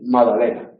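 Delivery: "Madalena" without the g; dropped g. Shown as a demonstration. Teacher mocks "Madalena" without the g. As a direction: drop the g. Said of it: "no hacerla desaparecer".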